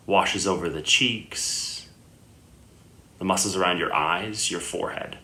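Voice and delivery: unhurried meditation guidance with long pauses; guided meditation. Acted. Yoga teacher guiding listeners lying down into Yoga Nidra, calm meditation voice. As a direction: settle the listener gently, leaving long silences between phrases.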